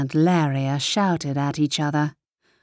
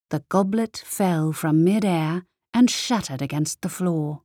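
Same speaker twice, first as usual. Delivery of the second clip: ordinary delivery, nothing marked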